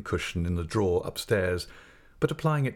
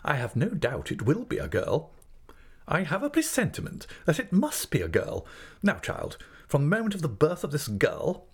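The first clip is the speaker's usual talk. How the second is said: high, emphatic certainty; opinionated aunt, brooking no doubt; domineering aunt figure; 7 semitones higher than usual. Acted. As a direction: pronounce it firmly, pitched up.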